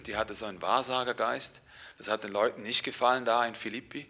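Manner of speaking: ordinary talk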